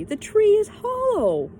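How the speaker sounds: high, loud, slow discovery; excited cry. A narrator's reading. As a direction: shout the news in wonder. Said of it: "she cried suddenly".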